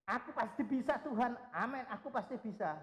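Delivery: mock brash boast. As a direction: blurt the promise too eagerly. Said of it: "lalu kita lantang langsung".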